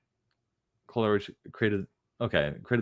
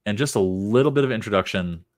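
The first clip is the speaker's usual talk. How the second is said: ordinary talk, nothing marked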